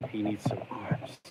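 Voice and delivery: slow whisper to himself. Real, not acted. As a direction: whisper it like a secret. Murmuring to himself mid-build.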